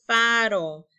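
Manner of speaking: "Faro" said loud and falling; model word. Shown as a demonstration. Teacher models "Faro" for the class to repeat, loud. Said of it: "Repitan conmigo".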